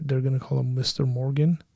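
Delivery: ordinary talk, nothing marked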